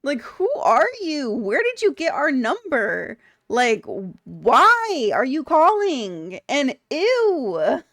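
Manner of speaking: high, loud, drawn-out disgusted disbelief; indignant retort. Retold or imitated. Retelling a reply to a creepy caller, indignant and grossed out. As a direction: stretch each question out, appalled at the caller. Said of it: "'cause I was like".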